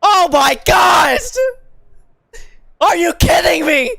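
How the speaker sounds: high loud slow disbelief; shocked shouting. Real, not acted. Stunned by last-lap result.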